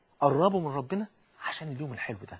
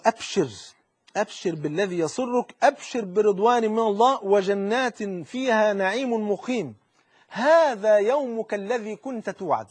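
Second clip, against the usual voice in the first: slow raised proclamation; 3 semitones higher, slower than usual. Acted. Angel proclaiming paradise to the believer, slow and ringing. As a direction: ring out the good news slowly. Angel's good news, first telling.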